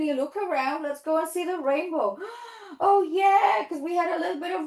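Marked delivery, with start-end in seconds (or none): 2.2-2.8 quick pretend gasp of discovery; excited gasp. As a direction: gasp as if spotting something wonderful. Excited pretend sighting of rainbow.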